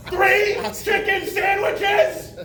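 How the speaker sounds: very high, drawn-out mock yell; overdone screech into the speaker; hammed-up deaf shout. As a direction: shout the order slowly, like someone deafened.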